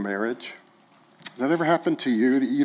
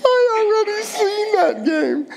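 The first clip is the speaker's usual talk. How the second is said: put-on very high Arabic-accented grandfather; exaggerated Arabic accent, pitch through the roof; bad Arabic accent; 3 dB louder than usual. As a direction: squeal it in a comic Arabic accent, amused. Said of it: "forgive my bad Arabic accent here".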